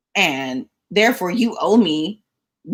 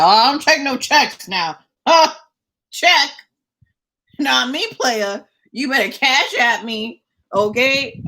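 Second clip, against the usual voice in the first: sassy refusal; 6 semitones higher, 3 dB louder than usual. Retold or imitated. Refusing the stylist's check.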